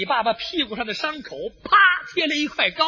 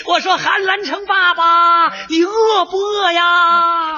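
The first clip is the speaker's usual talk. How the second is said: high, very stretched-out call; 4 semitones higher, 3 dB louder, much slower than usual. Acted. Soldier claiming kinship across the lines, calling out. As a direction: holler it with long drawn vowels.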